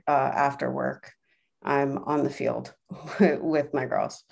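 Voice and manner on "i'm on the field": usual speaking voice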